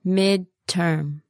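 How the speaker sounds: "Midterm" split into two slow syllables; slow word model. Shown as a demonstration. Teacher modelling "Midterm" for learners, slowly. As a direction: pause between the halves of "Midterm".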